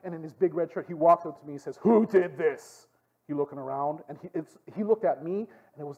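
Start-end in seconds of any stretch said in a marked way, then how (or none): 1.8-2.9 drawn-out accusing demand; stern guard. Retold or imitated. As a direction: act out a guard demanding answers. Acting out guard confronting him.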